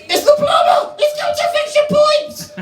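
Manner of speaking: very high, loud parrot squawk; parrot mimicking. Parrot answering the old lady in the plumber's words.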